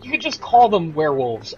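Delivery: high-pitched exasperated whine. Real, not acted. Player griping about the term Lycan, high exasperation. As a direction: protest in a high, pained voice. Ranting at game's word Lycan.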